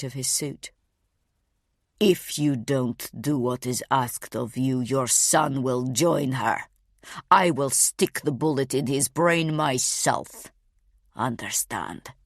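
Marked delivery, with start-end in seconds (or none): 2.0-12.1 a low measured threat to a terrified mother; cold menacing threat. Acted. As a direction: speak down to her like a calm killer.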